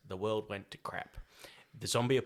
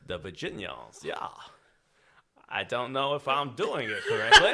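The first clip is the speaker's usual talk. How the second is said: bad Virginian accent; 2 semitones higher, 7 dB louder than usual. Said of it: "his horrible Virginian accent".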